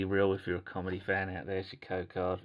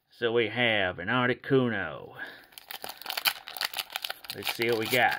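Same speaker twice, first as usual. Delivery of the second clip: put-on dodgy American accent. YouTuber opening a card pack for laughs, dodgy American accent. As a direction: attempt an American accent, sounding a bit like a cowboy. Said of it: "gonna try my best American accent".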